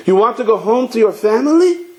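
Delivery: high voice climbing steeply on "family"; incredulous echo question. Acted. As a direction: throw it back, rising high through "family". Rebbe echoes it as a question.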